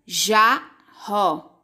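"Jarro" split into Jar, ro; "Jarro" with a pause between its syllables. Shown as a demonstration. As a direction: sound out "Jarro" in two beats, full final o. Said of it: "Quantas sílabas?".

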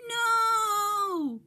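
high, loud despairing no; high cry of loss from a toy engine. Acted. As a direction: wail it at losing a friend. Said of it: "cried Percy".

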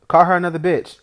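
a brother shouting a dare at his brother; loud dare. Acted. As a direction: shout the dare, daring him to try. Daring brother to repeat insult.

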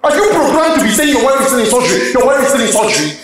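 exasperated, high-pitched outburst; angry shouting. Acted. Husband demanding nurse call about his wife, exasperated shouting. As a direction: explode at her in frustration. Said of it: "you don't call me and shout".

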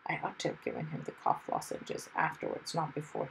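soft, private musing; thinking to himself. A narrator's reading. Hero regretting the cough lozenges, quiet private thought. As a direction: think aloud under your breath. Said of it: "he thought".

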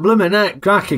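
ordinary talk, nothing marked

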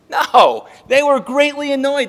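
loud, high burst of correction; emphatic rebuttal. Real, not acted. Preacher knocks down the imagined friendly welcome, loud emphatic rebuttal.

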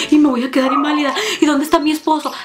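jittery patient pestering the doctor; intense, anxious questions. Retold or imitated. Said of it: "Pero intensísima".

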